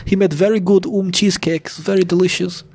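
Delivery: high, lightly voiced German accent. Voicing Hitler's mourners again.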